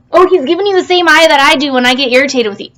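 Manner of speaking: ordinary delivery, nothing marked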